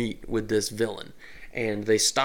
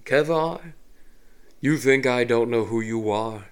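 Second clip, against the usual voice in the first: smug villain voice. Acted. Villain addresses the paladin.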